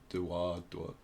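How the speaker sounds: normal talking voice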